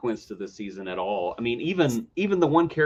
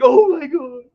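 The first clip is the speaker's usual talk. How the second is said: mock delighted gasp; 13 semitones higher, 9 dB louder, slower than usual. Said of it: "you're just like".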